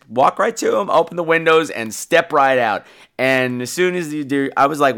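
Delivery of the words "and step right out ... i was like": ordinary talk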